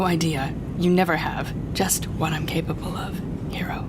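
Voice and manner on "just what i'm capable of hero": breathy whispered hero, mocking; whispered taunt. Villain whispering menacingly to the captive hero. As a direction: hiss the word softly to unsettle him.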